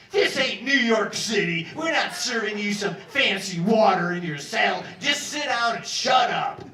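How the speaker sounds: loud, slow furious shout; guard shouting. Retold or imitated. Guard shouting at the prisoner asking for water, loud and slow. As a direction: yell him down through the window. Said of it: "He's like".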